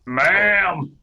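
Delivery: loud, high hail; calling out. Pretend. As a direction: holler to get someone's attention. Players hailing a game character.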